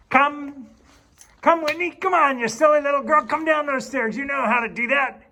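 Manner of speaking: high, loud sing-song call to a dog; high coaxing call. Real, not acted. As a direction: coax the reluctant dog down.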